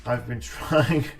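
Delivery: normal talking voice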